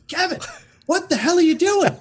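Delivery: high, loud shocked outburst; acted outraged father catching his son; furious dad. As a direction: explode in furious disbelief. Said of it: "Oh, he was furious".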